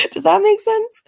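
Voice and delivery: asked through a high laugh; laughing while she asks viewers. Real, not acted. Talking to her viewers, laughing as she asks.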